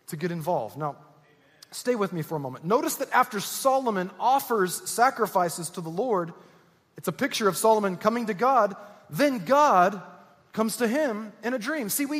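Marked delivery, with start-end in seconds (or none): none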